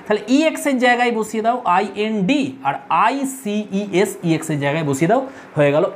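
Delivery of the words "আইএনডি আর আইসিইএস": drawn-out letter by letter; slow spelling. Shown as a demonstration. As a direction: spell আইসিইএস out very slowly. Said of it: "spelling the ending".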